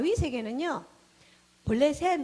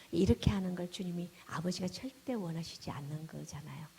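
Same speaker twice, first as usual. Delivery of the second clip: ordinary delivery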